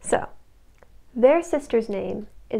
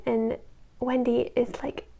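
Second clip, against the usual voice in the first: ordinary delivery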